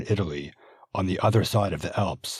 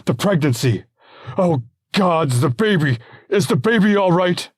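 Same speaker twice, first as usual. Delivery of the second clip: high, loud frantic worry; a father terrified for his unborn child; husband fearing for the baby; panicked; 11 semitones higher, 9 dB louder than usual. Acted.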